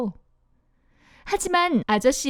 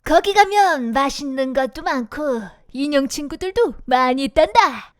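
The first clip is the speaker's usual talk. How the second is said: sly coachman. Acted. Coachman tempts Pinocchio.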